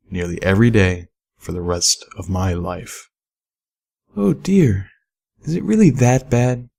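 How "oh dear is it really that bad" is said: higher, gentle concerned mare; Velvet Remedy's voice. A narrator's reading. Narrator voicing the singer's concern.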